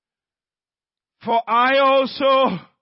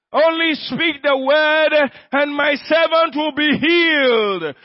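chant-like declaration building higher; full-force preaching; 4 semitones higher than usual. Real, not acted. Preacher declaring healing over congregation, building to high full-force cry. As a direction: drive each repetition harder, stretching the endings.

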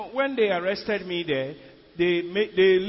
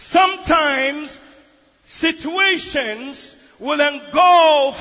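slow, loud preaching, pitched up; full force preaching; 6 semitones higher, 9 dB louder, slower than usual. Acted. Preacher to congregation, loud slow preaching. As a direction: drive the verse home from the pulpit.

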